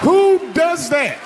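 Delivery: high, slow, shouted disbelief; outraged shout. Real, not acted. Comedian outraged at his wife opening the box, shouting high. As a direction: yell it in stunned outrage.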